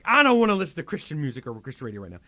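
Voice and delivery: loud, fast, irritated outburst; retold fit. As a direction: blurt it out like an acted tantrum. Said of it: "I'm just having a fit".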